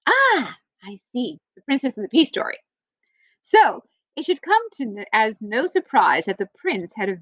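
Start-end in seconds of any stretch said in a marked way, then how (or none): none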